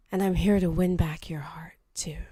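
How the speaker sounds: breathy flirty whisper. Acted. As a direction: whisper it seductively. [name] whispers a flirty line.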